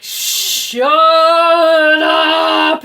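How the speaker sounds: long, drawn-out high yell; exasperated parent bellowing at squabbling kids; fed-up dad shout. Retold or imitated. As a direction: yell it like a fed-up father, stretching each word. Furious dad cuts off squabbling kids.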